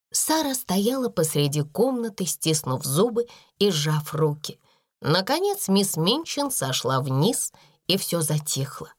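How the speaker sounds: usual speaking voice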